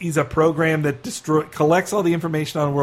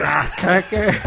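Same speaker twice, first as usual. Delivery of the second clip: Bob Hope impression. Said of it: "do Dana Gould's Bob Hope".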